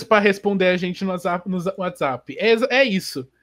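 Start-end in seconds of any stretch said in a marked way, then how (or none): none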